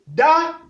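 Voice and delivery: Da on a high, level, loud tone. Shown as a demonstration. Teacher reading syllables, Da on high tone. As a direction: hold Da high and even. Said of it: "ton haut".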